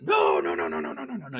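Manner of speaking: strings of no, pushed hard in a retold shout; mock shouted refusal; retold emphatic no. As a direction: fire off the no's like an alarmed officer. Officer rejecting the school choice.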